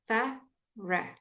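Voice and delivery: thorough in two parts, thuh ... ruh; teacher's separated thorough, both vowels uh; syllable by syllable. Shown as a demonstration. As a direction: pause between the halves of thorough. Teacher splits word into syllables.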